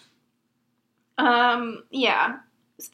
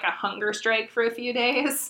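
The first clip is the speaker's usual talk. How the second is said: normal talking voice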